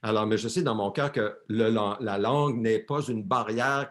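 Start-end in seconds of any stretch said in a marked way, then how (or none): none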